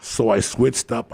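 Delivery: normal talking voice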